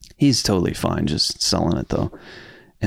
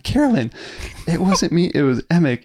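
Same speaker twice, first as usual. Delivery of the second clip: much higher, urgent "it wasn't me"; high pleading protest; 9 semitones higher than usual. Retold or imitated. Student pleading innocence to angry gym teacher, high protest. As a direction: plead your innocence in a squeaky rush.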